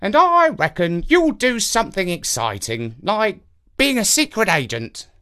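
high odd crow voice; knowing old crow; raspy cawing crow. Acted. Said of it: "said the crow".

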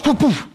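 two quick high "poof" sound effects; vocal noise of punches being blocked; short falling puffs of impact; imitated blows. Imitated blows in retold fight.

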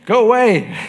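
high, loud shooing yell; a hammed-up brush-off; mock shout. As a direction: shout it away at the devil. Shooing the devil away.

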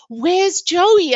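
high, loud retold "where is he?"; retold bewildered call. Labouring wife asks midwives where her husband is, bewildered and loud.

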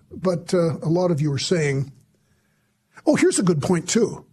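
ordinary talk

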